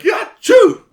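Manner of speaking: loud, very high fake sneeze; mock sneeze. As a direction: blast out a cartoon sneeze. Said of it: "she's like".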